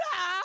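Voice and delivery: high, loud anime battle cry; mock anime shout. Friend imitating anime fight noises, mock battle shout. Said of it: "where they go".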